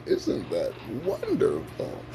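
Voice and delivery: hushed, dry sarcasm; quietly sardonic; sarcastic. Real, not acted. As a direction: drop to a soft, mocking aside. Sarcastic aside on senseless violence.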